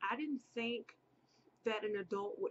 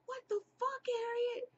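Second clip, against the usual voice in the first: high squeaky mock-shocked audience; hammed-up high disbelief; audience reaction; 13 semitones higher than usual. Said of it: "y'all probably like".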